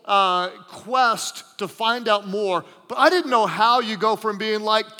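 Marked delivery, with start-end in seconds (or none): none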